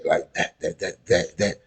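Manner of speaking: "that" with a quick dental d. Shown as a demonstration. Reactor demoing "that" said quickly as "dat". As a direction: repeat "that" fast with the tongue at the teeth. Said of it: "we not actually saying D".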